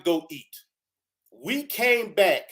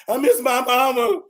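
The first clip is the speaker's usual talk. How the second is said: mock whimper; 7 semitones higher, 4 dB louder than usual. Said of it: "he's crying his eyes out".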